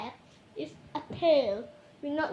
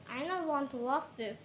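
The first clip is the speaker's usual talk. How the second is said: ordinary delivery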